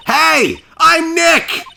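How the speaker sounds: high-pitched full-volume yell; loud shout. Acted. Nick shouting at a noise-triggered camera, loud. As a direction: belt out the introduction to trigger a response. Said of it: "Maybe I'm not saying it loud enough".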